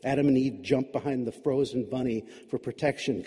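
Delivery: usual speaking voice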